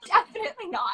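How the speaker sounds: high, loud laughing definitely not. Real, not acted. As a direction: burst out laughing as you say it. Laughing at mangled name.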